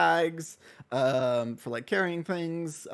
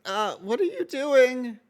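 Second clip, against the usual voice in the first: put-on much higher pleading boy voice; nervous Mortimer; 8 semitones higher, much slower than usual. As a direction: plead with the customer. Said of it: "he's gonna say".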